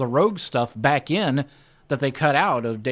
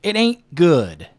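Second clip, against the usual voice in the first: mock American accent; 6 dB louder, much slower than usual. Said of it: "Henry Cavill's American accent".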